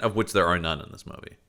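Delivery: normal talking voice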